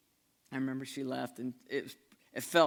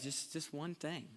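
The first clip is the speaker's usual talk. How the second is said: soft, slow, worn-out plea; weary plea; 3 semitones higher, 7 dB quieter, slower than usual. Retold or imitated. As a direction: barely ask for it. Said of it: "he just says".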